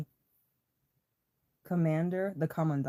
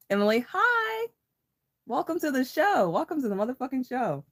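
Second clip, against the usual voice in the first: high excited greeting; 8 semitones higher than usual. Real, not acted. Greeting viewers joining live.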